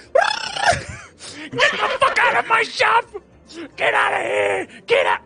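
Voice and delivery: high, shrieking furious yell; furious shout. Acted. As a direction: scream them out of the shop. Angry chicken bartender yelling.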